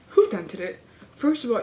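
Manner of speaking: ordinary delivery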